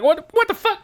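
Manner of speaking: very high, squeaky bafflement; startled disbelief. Retold or imitated. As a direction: yelp it in mock shock. Acts out startle as cat flees.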